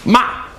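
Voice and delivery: "Ma" starting high and dropping sharply; short, sharp falling "Ma". Shown as a demonstration. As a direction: throw "Ma" down fast from a high pitch. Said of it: "goes down sharply".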